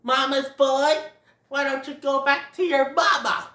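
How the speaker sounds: mock him like a kid; taunting Thumper. Thumper taunting Ronald, sneering. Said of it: "Thumper's all like".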